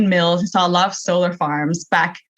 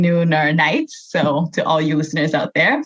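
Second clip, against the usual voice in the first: normal talking voice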